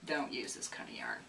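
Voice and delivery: hushed, low confiding aside; conspiratorial whisper to viewers; whispered warning. Real, not acted. Word to the wise warning.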